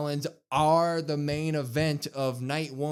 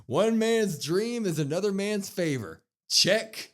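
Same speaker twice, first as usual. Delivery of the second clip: smug taunt; 8 semitones higher, 4 dB louder, slower than usual. Retold or imitated. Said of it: "by saying".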